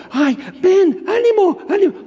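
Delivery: falsete meloso muy agudo; dulzura hipócrita. Retold or imitated. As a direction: empalagoso fingido, burlándose. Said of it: "Hipócritas". (voz melosa e hipócrita de la multitud)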